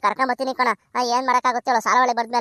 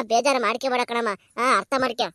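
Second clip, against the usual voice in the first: ordinary talk, nothing marked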